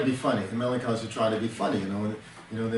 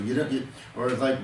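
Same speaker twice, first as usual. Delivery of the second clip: ordinary delivery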